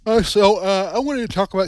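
talking through a yawn; words stretched by a yawn. Real, not acted. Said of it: "excuse me for yawning there".

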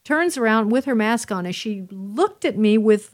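usual speaking voice

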